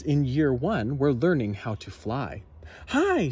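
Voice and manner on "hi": high, bright teacher call; Madam Dragon. A narrator's reading. Madam Dragon calling to her flying class, high. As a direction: sing it up like a dragon schoolmistress. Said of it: "said Madam Dragon".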